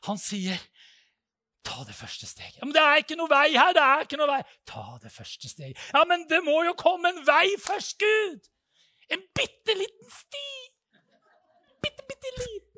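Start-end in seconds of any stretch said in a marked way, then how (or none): 1.7-2.6 quiet reply. Retold or imitated. God answers the pleading man. 2.6-4.4 loud protest. Retold or imitated. Arguing back at God. 4.7-5.9 quiet reply. Retold or imitated. God answers again. 5.9-12.7 high pleading. Retold or imitated. Bargaining with God, pleading.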